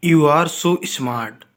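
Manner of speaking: each word stretched very slowly; slow model sentence. Shown as a demonstration. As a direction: spell out You are so smart for learners. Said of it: "teacher modelling sentence slowly".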